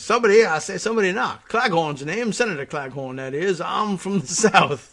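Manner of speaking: hammed-up Southern politician announcing himself; Senator Claghorn. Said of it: "that's how he would answer this".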